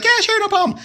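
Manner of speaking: high, bright mock of the Blockbuster clerk; lightly voiced young woman clerk answering; imitating her voice. Imitating the clerk answering the phone, mock high voice. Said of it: "She's like".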